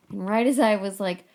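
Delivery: normal talking voice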